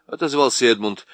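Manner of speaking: usual speaking voice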